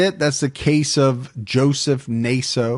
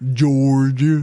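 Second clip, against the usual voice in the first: mock southern twang on one word; attempted southern accent; 4 dB louder than usual. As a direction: mimic a Georgia accent, badly. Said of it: "That's not a southern accent".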